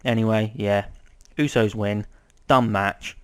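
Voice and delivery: usual speaking voice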